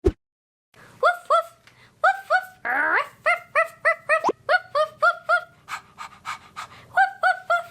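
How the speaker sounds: high, repeated puppy woofs and ruffs; pretend dog barks. Being doggies together with children, high playful barking. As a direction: bark like an eager little doggy. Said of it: "Let's be doggies together".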